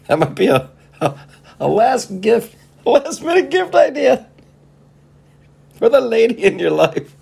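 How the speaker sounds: high and loud through laughter; cracking up, voice high and loud; laughing squeal. Real, not acted. Laughing while joking.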